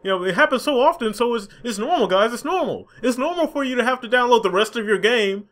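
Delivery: high, patronizing sing-song; mocking the publisher excusing downloads, high and patronizing; mock corporate reassurance. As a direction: soothe gamers like a condescending PR rep. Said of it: "using that safe term".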